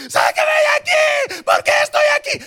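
high-pitched, full-force panicked scream; shrieked plea at the top of the voice. Acted. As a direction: scream like a prisoner begging to be let out. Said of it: "estuviéramos gritando".

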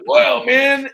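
high, loud wrestler exclamation; big, loud character voice; Macho Man impression. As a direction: belt it out like a wrestling showman. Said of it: "like, Macho Man going".

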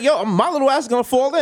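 high mock kid's panic; scared child voice. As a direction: squeal it like a tiny scared child. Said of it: "my thing is like".

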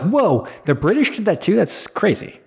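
amazed, voice lifting high; mock astonishment. Retells own astonished reaction.

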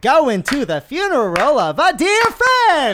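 loud, high, drawn-out singer impression. Player improvising an answer in the game, hollered impression. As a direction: holler it slowly in rhythm. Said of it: "an impersonation of [name]".

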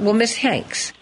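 normal talking voice

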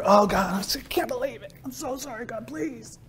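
high, soft, pleading whimper; mock anguished confession. Sinner begs God seconds after sinning, high acted whimper. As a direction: plead in a small high voice, hammed-up remorse.

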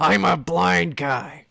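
loud, very drawn-out impression; Al Pacino. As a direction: bellow slowly like a hammed-up film star. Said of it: "I'm Al Pacino!".

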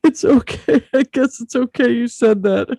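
high, loud fake weeping; hammed-up tearful whine; mock crying. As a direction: pretend to cry at a joke. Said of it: "Or crying at something funny".

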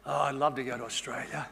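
low, soft mock longing; wistful admirer. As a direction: quietly imitate a dreamy would-be traveller. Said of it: "They say".

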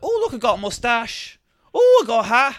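high-pitched voice of a playful granny; mock delighted Nan. Voicing Nan playing with pipe cleaners.

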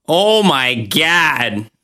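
slow, drawn-out Midwestern oh my God; Midwestern accent. Retold or imitated. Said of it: "as you guys might say it".

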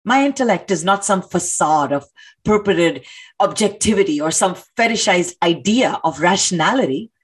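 usual speaking voice

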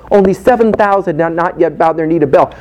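ordinary delivery

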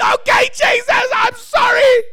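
high, loud, tearful begging; desperate crying plea. Retold or imitated. Preacher pleading with Jesus in his head, high tearful cry. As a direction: cry out for mercy, overwhelmed. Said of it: "in my head, I went".

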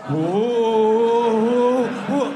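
loud long drawn-out whoa; whoa, held and loud; surprised whoa. Acted. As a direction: cry out in mock astonishment. Shocked exclamation.

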